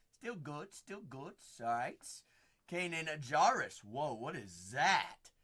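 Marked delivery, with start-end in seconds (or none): none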